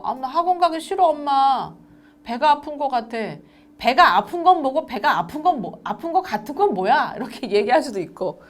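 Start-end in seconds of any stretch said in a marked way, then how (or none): 0.0-3.2 put-on higher, louder kid's whine; pleading, put-on child complaint; whining child. Voicing child making excuses. 3.8-7.1 loud, higher mock scolding; raised, nagging retort; exasperated parent. Voicing annoyed parent's retort.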